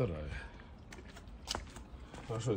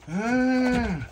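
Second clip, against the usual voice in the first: surprised; 11 semitones higher, 7 dB louder than usual. Real, not acted. Surprised at dog.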